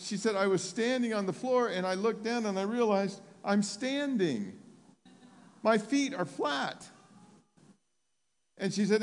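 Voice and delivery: high-pitched, impassioned testimony retelling; excited preaching. Retold or imitated. Preacher retelling a woman's healing to the congregation, excited preaching.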